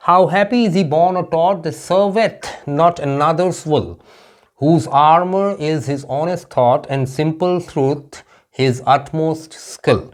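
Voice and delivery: slow, measured reading of verse; slow classroom poetry reading; poem recitation. Acted. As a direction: recite it line by line, very slowly.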